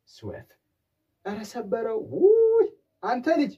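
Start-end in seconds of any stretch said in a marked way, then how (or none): 1.3-3.5 put-on high, loud cry at the child; mock scolding parent. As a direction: scold the child who broke it. Scolding child who broke glass.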